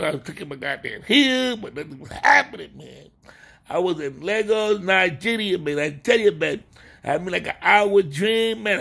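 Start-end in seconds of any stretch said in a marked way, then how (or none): none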